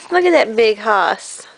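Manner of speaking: low, big-guy voice; deep hoss voice. Pretend. Deep voice, then plain.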